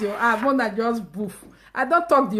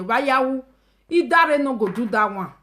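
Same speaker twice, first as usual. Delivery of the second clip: usual speaking voice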